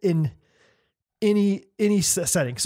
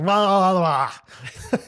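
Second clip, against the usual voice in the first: mock tongue-tied babble; garbled, exaggerated fumbling; stumbling over words; much slower than usual. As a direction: imitate someone tripping into nonsense syllables. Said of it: "when they stumble over their words".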